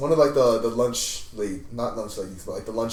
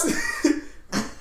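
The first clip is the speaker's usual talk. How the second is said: breathy laugh; 11 semitones higher, 5 dB quieter than usual. Real, not acted. Laugh after shock.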